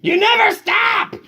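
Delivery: loud, high shout; exasperated yell. Acted. Mother yelling at the nonstop dog, loud and high. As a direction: yell it in full frustration. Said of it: "yelled Mother".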